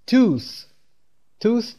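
توث with a long, heavy oo; drawn-out oo. Shown as a demonstration. Teacher modelling tooth for learners, long oo. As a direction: stretch the oo in توث before the final th. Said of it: "با صدای یو کشیده".

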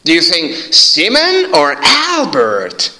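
slow question, pitch swinging up on "Simon". Shown as a demonstration. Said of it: "تون صدات باید سوالی باشه".

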